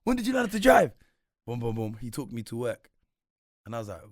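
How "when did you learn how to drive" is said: high, loud amazed question; shocked disbelief. Retold or imitated. Younger brother finding out his brother has a car, loud amazed question. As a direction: sound floored that he has a car.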